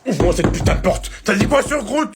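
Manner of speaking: loud, high furious shout; furious yelling. Acted. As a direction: yell it through the door, outraged. Mother furious over Groot insult.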